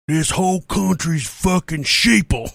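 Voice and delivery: slow, sneering put-on voice; the man in line sneering at masks; imitating angry guy. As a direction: drag it out like a smug conspiracy guy. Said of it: "this dude behind me goes".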